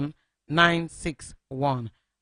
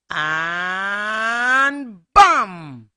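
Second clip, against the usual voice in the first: dramatic bam; 4 semitones higher, 7 dB louder than usual. A narrator's reading. Chicken crashes to the ground.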